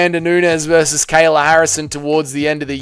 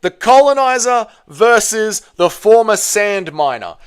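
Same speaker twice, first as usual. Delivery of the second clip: hype promoter pitch; 5 semitones higher, slower than usual. Acted. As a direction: sell it like a fight promoter.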